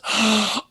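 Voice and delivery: sharp mock gasp; recognition gasp. Acts out friend recognizing him.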